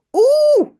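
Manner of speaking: high, loud startled squeal; frightened shriek. Acted. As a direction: shriek as the cow appears. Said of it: "gilt ze". (petit cri aigu de frayeur)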